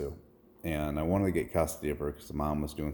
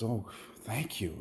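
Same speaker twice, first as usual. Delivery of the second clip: soft, slow, higher thanks; imitated gratitude; 4 semitones higher, 6 dB quieter, slower than usual. Man thanks a stranger for money, softly grateful. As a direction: imitate someone quietly overcome with thanks. Retells the man's grateful reply.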